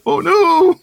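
very high, loud cartoonish wail; mock dismay. Imagining the burger falling out the side, mock dismay. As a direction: wail it in exaggerated alarm.

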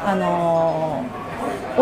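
normal talking voice

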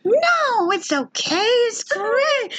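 very high forced-cheerful reassurance; squeaky reassurance. Retold or imitated. Reassuring the upset receptionist, very high cheerful. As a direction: chirp it way up high. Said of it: "I'm like".

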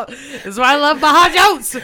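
loud, high laughing delight. Real, not acted. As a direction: laugh it out with glee. Laughing at the owner's reply.

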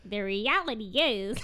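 slow, loud toy voice; like a talking doll on a string; pull-string doll voice. Retold or imitated. Girlfriend mocking his catchphrase as a talking doll. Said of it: "it would say".

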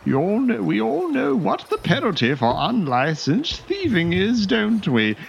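very high tut-tutting dandy voice; high prissy dandy, mock sorrow; mock-pitying fop. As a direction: sigh over the thief's fate with false sympathy.

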